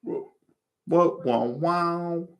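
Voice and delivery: high-pitched amazed what, wow. Real, not acted. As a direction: squeak out the wow in disbelief. Reacting to a scene onscreen.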